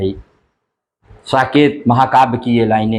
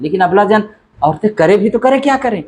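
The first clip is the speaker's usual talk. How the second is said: ordinary talk, nothing marked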